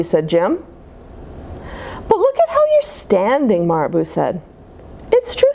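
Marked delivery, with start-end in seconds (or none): 2.1-3.7 high bird voice. Acted. 5.1-5.5 squeaky agreeing. Acted. Norman chimes in.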